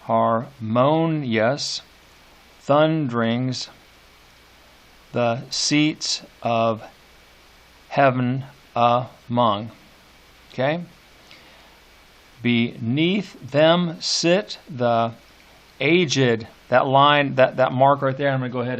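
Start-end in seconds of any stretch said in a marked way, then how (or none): 0.0-9.7 slow, deliberate, each beat weighed; slow scanned reading. Shown as a demonstration. Teacher rereading the line slowly to show its beats. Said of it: "go back and say this". 12.4-16.5 slow, "aged" set apart in two syllables; slow scanned reading. Shown as a demonstration. Teacher reading slowly to show stress on aged. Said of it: "give it a stress on the first syllable".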